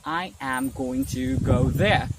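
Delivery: slow, every word separated. Shown as a demonstration. Teacher showing learners the slow way to avoid, word-by-word. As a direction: say each word on its own, unmerged. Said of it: "instead of saying".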